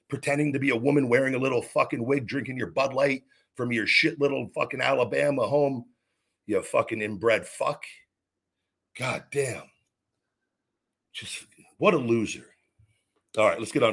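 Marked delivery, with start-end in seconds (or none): none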